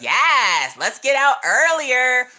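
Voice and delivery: loud, drawn-out retold celebration; mock cheer. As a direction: play up the cheer, slow and high. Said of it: "And I was like".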